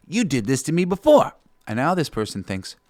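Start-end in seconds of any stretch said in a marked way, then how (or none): 0.1-1.3 higher, louder accusation; accusing outburst. Acted. Finally confronting someone who upset you, accusing. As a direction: confront them with a pent-up grievance.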